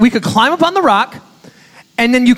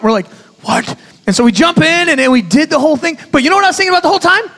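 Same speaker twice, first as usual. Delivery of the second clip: excited storytelling; 7 semitones higher than usual. Real, not acted. Excited retelling of jump.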